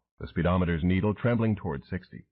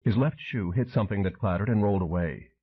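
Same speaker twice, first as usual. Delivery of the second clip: ordinary delivery, nothing marked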